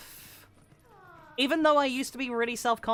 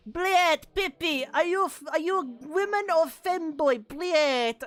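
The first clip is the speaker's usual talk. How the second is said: mocking gamer voice; 7 semitones higher than usual. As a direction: lightly mimic a rude gamer. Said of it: "C S go players being like".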